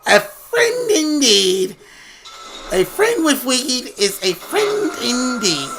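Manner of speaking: high, slow, drawn-out leprechaun voice. Acted. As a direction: ham it up as a sly leprechaun. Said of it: "I like doing Leprechaun's voice".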